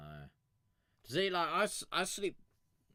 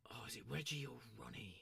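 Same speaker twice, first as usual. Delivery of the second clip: whisper; 11 dB quieter, faster than usual. Real, not acted. Whispered aside to self.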